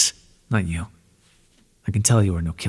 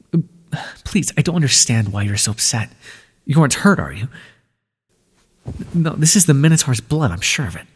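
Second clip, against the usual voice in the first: ordinary talk, nothing marked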